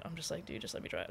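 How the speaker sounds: ordinary talk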